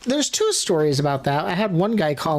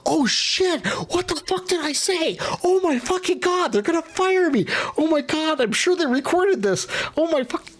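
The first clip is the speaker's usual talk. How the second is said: high panicked self-talk; freaking out in a much higher voice; retold panic after hanging up; 13 semitones higher than usual. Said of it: "I'm like".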